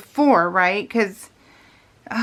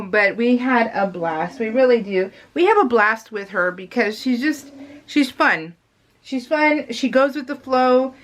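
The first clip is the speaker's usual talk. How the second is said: ordinary talk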